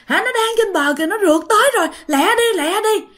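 high, urgent pleading to hurry; panicked cry. Acted. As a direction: hurry him along in a high, frightened voice. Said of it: "nghe cái bà này la".

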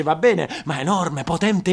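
ordinary delivery

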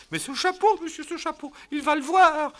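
high loud frightened lady's voice; anxious wife. Acted. Wife fearing her husband will see the hat. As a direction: squeak it out in panic.

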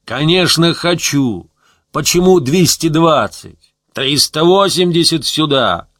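loud, high, drawn-out defiant yell; defiant bellow with stretched words. A narrator's reading. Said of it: "заорал Гусев".

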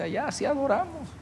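high, lightly put-on quote; imitating former member. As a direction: mimic a young worshipper explaining himself in high pitch. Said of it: "le dice".

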